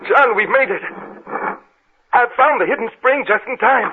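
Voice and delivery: relieved triumph. Acted. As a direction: burst out with relief. Escape found at last.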